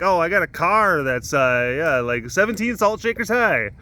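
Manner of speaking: high-pitched mocking brag; mock boast. Teasing dad about salt shakers, mock bragging. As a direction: parody someone bragging in a silly unit.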